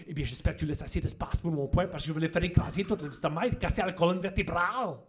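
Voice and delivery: fast, hard-hitting French action voice; French action-movie voiceover; tough French dub. Retold or imitated. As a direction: dub Arnold with menace in French. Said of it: "would at least sort of sound like".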